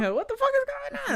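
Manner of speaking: very high, fast panic; frantic freakout; panicked. Retold or imitated. Voicing panicking girl.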